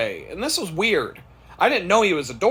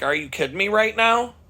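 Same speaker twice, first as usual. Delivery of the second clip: higher-pitched, incredulous comeback; retold disbelief; 6 semitones higher than usual. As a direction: answer a rude customer in mild disbelief. Retold reply to rude customer.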